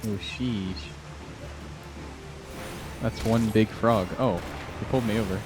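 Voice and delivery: ordinary talk, nothing marked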